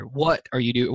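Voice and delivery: "What" hit first, the rest trailing; cut-off question with emphasis on "What"; stress on What. Shown as a demonstration. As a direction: lean hard on the opening "What". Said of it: "putting the emphasis on the different words".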